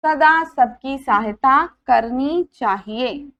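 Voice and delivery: lively teacher reading a story aloud; story reading for children. A narrator's reading. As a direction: read it to the class with expression. Teacher reads story to class. (बच्चों को कहानी सुनाती हुई)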